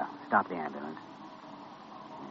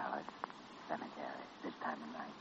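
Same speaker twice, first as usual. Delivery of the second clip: hushed, softer question; softly; 4 semitones lower, 15 dB quieter than usual. Acted. Doctor asking the girl, hushed. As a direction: keep it low so she isn't startled.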